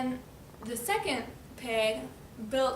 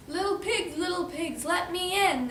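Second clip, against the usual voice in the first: higher, sing-song demand; storybook wolf calling; 6 semitones higher than usual. Acted. Wolf knocks for the pigs, high chanted demand. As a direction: chant it at the door.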